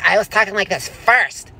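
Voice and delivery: high, feminine mock-argument voice; petulant, high-pitched feminine protest; effeminate squabble. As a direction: squabble in an exaggerated girly voice. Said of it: "feminine. You're like".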